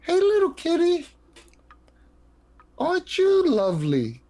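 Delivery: high sweet kitty voice; baby talk to cat. Acted. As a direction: coo at the kitty.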